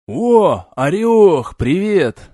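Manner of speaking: put-on loud drunken greeting; drunk hail. A narrator's reading. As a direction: call out, tipsy and loud. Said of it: "наконец заметил он Олега".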